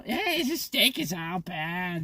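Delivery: imitating a woman griping about her steak; mock complaining woman. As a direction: drag it out like a fussy diner. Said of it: "She, the steak was all".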